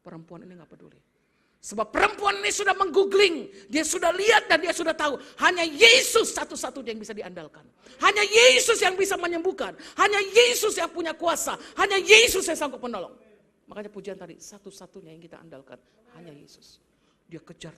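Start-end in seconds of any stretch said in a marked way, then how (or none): 1.6-13.1 loud, high preaching at full force; fiery preaching. Real, not acted. Preacher to congregation, preaching at full force. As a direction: proclaim it to the whole church.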